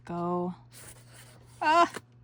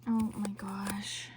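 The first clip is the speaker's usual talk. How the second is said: slow, hushed oh my gosh; awed whisper; 6 dB quieter than usual. Real, not acted. Crafter whispering oh my gosh at the kit. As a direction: breathe it out in wonder.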